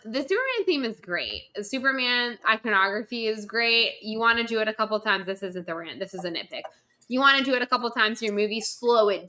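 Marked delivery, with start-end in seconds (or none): none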